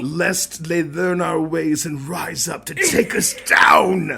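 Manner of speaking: slow, grand prophecy voice; dramatic doomsayer. Acted. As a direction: intone a dark warning slowly. Dramatic quote about smart dogs.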